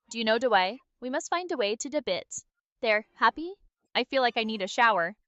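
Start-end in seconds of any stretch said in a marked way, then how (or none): none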